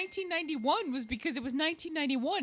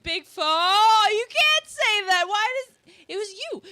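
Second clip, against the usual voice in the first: shocked; 9 semitones higher, 9 dB louder, slower than usual. Retold or imitated. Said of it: "I'm like".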